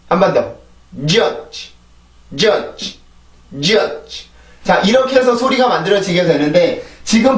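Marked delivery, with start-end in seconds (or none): 1.1-4.3 judge with a strong first j and a soft, released final dge; soft final consonant. Shown as a demonstration. Teacher contrasting both sounds, judge ending softly. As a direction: hit the start of judge, let the end go gently on leftover air. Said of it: "아주 부드럽고 천천히 발음을 해주면".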